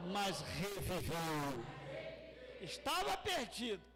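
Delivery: slow, forceful scripture declamation with long pauses; full-force preaching. Acted. Preacher declaiming the father's welcome to his lost son, full-force preaching. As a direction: proclaim each phrase to the congregation, building as it repeats.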